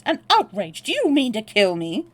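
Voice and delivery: frightened outburst, voice pushed high; high, hysterical. A narrator's reading. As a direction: cry out in outraged panic. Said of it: "hysterical voice".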